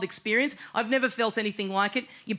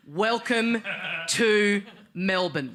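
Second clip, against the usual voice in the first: slow dramatic reading; much slower than usual. Retold or imitated. Reading the sign aloud, dramatic.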